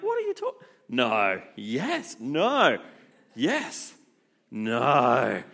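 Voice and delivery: flip-flop in a panic; caught-out groom losing it; panicked disbelief. Retold or imitated. Panicked groom realizing speech needed.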